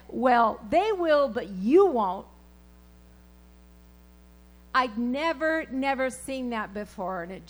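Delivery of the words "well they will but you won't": prophet's rebuke. Retold or imitated. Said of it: "and said".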